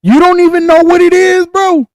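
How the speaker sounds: high, loud disbelief; can't believe he doesn't know; shocked that his friend never heard; incredulous. Real, not acted.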